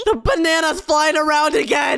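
high-pitched alarmed cry; alarmed outburst. Acted. Seeing the banana fly around again, alarmed outburst. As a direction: cry out in disbelief.

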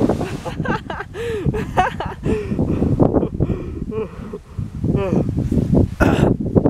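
long, high breathy laugh. Real, not acted. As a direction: burst into helpless laughter. Long laugh while filming.

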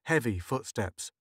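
usual speaking voice

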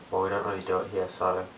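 normal talking voice

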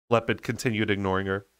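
ordinary delivery, nothing marked

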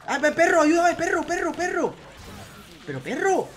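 high, loud cry for help; panicked calling. Real, not acted. Player calls on the dog for help mid-fight, panicked shouting.

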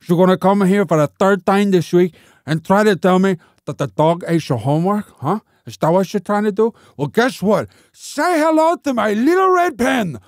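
threatening tough-guy teacher. Acted. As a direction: start as an interrogation, explode on the punchline. Teacher threatens pupil, movie-villain parody.

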